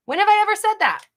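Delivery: high, loud, fast; indignant. Real, not acted. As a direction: fire it back indignantly. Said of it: "big sister wants to yell at you".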